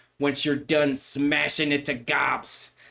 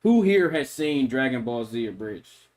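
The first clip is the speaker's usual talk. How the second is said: ordinary delivery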